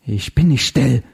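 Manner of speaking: tight, hissing refusal; hissed defiance. A narrator's reading. Zinzendorfer defying Heller's order, hissing. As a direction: hiss it back without raising pitch. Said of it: "zischte sie".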